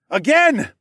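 high, loud battle command; yelled order. A narrator's reading. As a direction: yell for another strike. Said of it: "I yelled".

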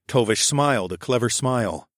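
usual speaking voice

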